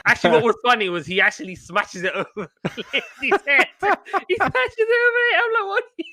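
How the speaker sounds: cracking up while retelling, voice shooting high; helpless giggles mid-story; real laughing. Real, not acted. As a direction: struggle to get the words out through laughter. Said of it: "That actually made me laugh".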